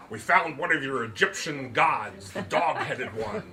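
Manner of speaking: mock the statue while laughing; laughing mockery. Andrew to Lizzie about the stuffed dog, laughing.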